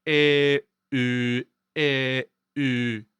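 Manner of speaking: sustained "E", rounding the lips into "U"; vowel rounding demo. Shown as a demonstration. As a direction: alternate "E" as eh and "U" as rounded ö, held level. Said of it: "Say an E sound and then round your lips".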